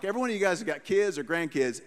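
usual speaking voice

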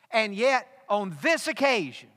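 full-force sermon climax; preaching. Acted. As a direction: drive the point home from the pulpit.

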